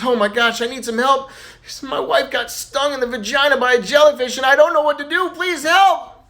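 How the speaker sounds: mock panic, pitch climbing steadily; freaking out. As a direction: plead for help in acted, rising alarm. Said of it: "Little Johnny's freaking out".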